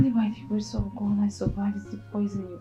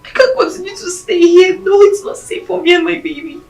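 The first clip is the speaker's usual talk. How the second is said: upset pleading; 4 semitones higher, 7 dB louder than usual. Acted. Frightened girl pleading with father.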